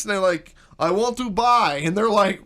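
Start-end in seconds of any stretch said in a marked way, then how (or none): 0.8-1.8 imitated Russian accent. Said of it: "when the Russians came over".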